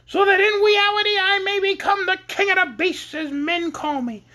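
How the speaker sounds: high pleading Lion voice; Scarecrow-like Lion. Acted. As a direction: beg for courage in a high quavery voice. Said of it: "sounded suspiciously like the Scarecrow".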